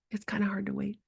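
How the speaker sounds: soft unvoiced whisper, kind. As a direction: hush it like a secret to a child. Whispering to children.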